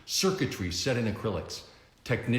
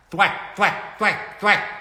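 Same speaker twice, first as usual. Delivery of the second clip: higher, louder mouth-made impacts; thwack sound effects; 11 semitones higher, 6 dB louder, slower than usual. Acted. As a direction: punch each thwack. Batarangs hitting.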